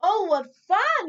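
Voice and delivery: high, loud joyful cry; delighted. Acted. Corduroy sliding in salt, loud delighted cry. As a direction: squeal with delight while sliding. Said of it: "he said with a smile".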